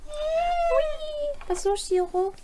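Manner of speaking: very high squeaky pet voice. Real, not acted. Talking to the dog.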